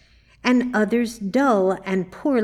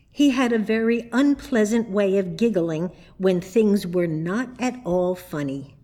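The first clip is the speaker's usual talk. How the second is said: usual speaking voice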